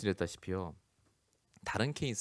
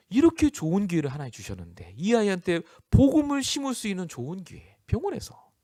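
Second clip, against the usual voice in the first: rising realization; 9 semitones higher, 4 dB louder than usual. Retold or imitated. Mother realizing the hospital stay is a chance, rising excitedly. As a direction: voice climbing higher and louder as she sees it.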